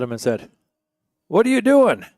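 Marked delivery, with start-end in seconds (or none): 1.3-2.1 much higher, louder baffled question; the farmer is bewildered; puzzled farmer. Acted. Farmer baffled by the Englishman's wiggling thumb, loud.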